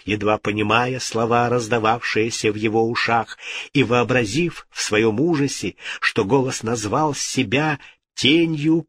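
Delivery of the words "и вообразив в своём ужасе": ordinary delivery, nothing marked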